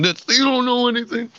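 high, indignant snap; hammed-up fan fury; mock angry outburst. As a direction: tell them off in mock rage. Acting out his sports rage.

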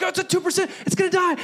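wife's panic. Retold or imitated. Preacher imitating wife Brooklyn panicking over her phone, high whine. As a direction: squeal about the battery. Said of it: "She's like".

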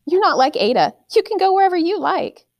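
a mother sweetly indulging her son in a high voice; mother's sweet voice. A narrator's reading. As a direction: coo at the little boy in a high voice. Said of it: "To Jamie, she said".

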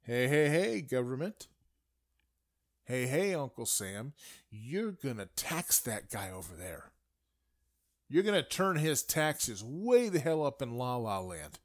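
loud, hammered hey hey calls; mock shout at government. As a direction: yell it up at Uncle Sam like a fed-up citizen. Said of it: "you look around and you say".

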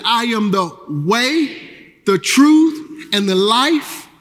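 rousing, slow congregational call; call and response. Acted. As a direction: lead the room through the verse, slowly. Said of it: "repeat this with me".

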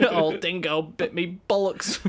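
slow, broad Aussie drawl, laughing; exaggerated Australian impression; mock Australian accent. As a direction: play an old Australian. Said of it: "Aussie, Aussie words".

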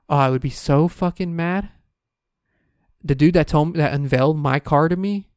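ordinary talk, nothing marked

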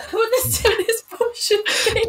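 very high, squealed through laughter; laughing repeat. Real, not acted. Repeats it right after laughing.

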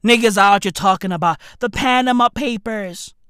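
usual speaking voice

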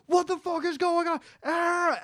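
high mock panic with a yelp; panicked residents. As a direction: play the panicking crowd. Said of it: "They're like".

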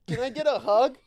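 high, loud mock fan plea; pleading fan. Host imitating fan outside [name]'s house, high mock pleading. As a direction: beg like an overeager fan, exaggerated.

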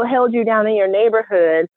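ordinary talk

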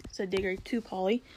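usual speaking voice

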